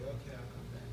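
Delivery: very soft, meek acted reply; hushed retreat. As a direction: back out quietly, barely audible. Acts his meek retreat from clerk.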